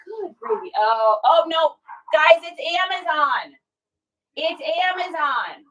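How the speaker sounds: sudden high "Good gravy"; startled exclamation. Real, not acted. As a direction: caught off guard by the doorbell. Dog reacts to a delivery.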